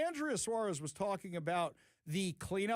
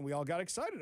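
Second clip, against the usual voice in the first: ordinary delivery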